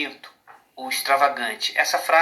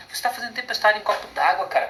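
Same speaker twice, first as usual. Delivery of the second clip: higher, quick imitated scolding; mock reproach; 6 semitones higher, faster than usual. Someone telling a friend he overreacts, mock reproach. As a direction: toss it off fast and high, imitating a reproach.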